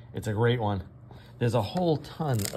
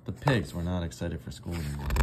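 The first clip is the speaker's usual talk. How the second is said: ordinary delivery, nothing marked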